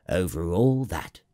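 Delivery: assured, level-pitched royal confirmation; answering king. A narrator's reading. King confirms his rule to the prince, assured. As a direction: confirm it with calm authority, falling at the end. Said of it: "the king answered".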